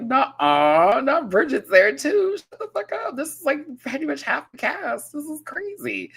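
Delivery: high-pitched excited squeal of disbelief; freaking out over a surprise guest; starstruck disbelief. Retold or imitated. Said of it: "I was like".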